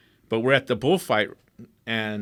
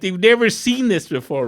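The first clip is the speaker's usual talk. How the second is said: normal talking voice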